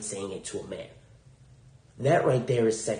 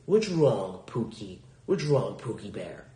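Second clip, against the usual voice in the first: ordinary talk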